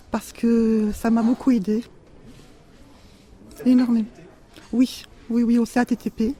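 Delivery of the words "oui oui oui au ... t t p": normal talking voice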